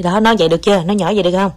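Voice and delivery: ordinary talk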